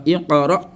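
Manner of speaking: "Iqra" with a bounced q, i-qa-ra; qalqala. Shown as a demonstration. Teacher answers what the rule gives, qalqala. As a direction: let the q of "Iqra" echo. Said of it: "Donc ça donne quoi?".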